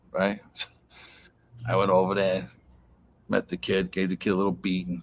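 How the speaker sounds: ordinary delivery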